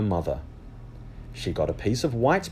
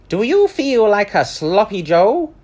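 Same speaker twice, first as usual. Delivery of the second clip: higher, louder friendly server; lunch lady voice; 11 semitones higher, 8 dB louder than usual. Acted. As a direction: offer food over the counter. Lunch lady asks Amelia.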